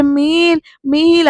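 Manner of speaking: high, drawn-out call. Retold or imitated. Jayanti running back again, high stretched call.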